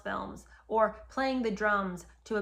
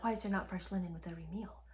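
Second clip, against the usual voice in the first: quick whispered mock quote; host mocking the ex-king's question in a fast whisper; entitled whisper; 4 semitones lower, 9 dB quieter, faster than usual. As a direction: toss off his entitled line in a hush. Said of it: "to be like".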